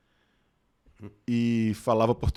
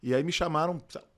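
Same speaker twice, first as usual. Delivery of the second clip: ordinary talk, nothing marked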